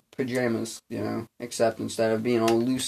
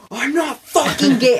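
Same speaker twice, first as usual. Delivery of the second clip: high, loud mock yell; retold angry shout; 9 dB louder than usual. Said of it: "I was like".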